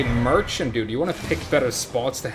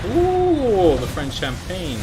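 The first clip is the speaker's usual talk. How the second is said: delighted ooh; 7 semitones higher, 6 dB louder, much slower than usual. Real, not acted. Admiring a champagne item.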